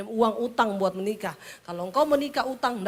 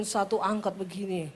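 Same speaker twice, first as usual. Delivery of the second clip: ordinary talk, nothing marked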